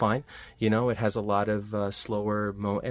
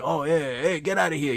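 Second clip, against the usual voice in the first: higher, louder mock Jersey accent; 7 semitones higher, 4 dB louder than usual. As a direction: ham up a New Jersey tough guy. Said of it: "starts talking like he's from Jersey".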